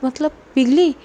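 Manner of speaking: plain reading voice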